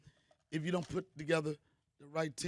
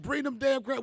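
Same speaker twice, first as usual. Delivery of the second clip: aunt barking an order, louder and higher; mock bossy aunt; 7 semitones higher, 6 dB louder than usual. Aunt to the kids, a mock bossy order. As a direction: play the aunt ordering the crabs cooked. Aunt voiced in retold story.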